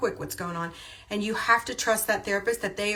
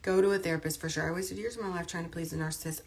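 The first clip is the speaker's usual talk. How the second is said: ordinary talk, nothing marked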